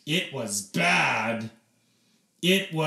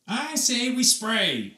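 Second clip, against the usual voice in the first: shouting; 9 semitones higher, 3 dB louder than usual. A narrator's reading. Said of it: "shouted Dad".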